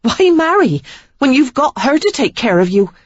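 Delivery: high, loud, scornful; indignant tirade. A narrator's reading. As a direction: tear into him about his mother. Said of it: "righteous indignation that sustained her".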